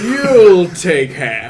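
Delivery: loud, slow, firm ruling; stern bartender. Acted. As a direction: lay down the law slowly. Bartender firmly cuts the order.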